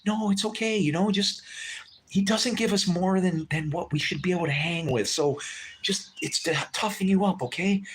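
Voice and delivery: patching up a crying stranger, tender and reassuring. Retold or imitated. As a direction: soothe her, lifted and gentle. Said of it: "patch her up and say".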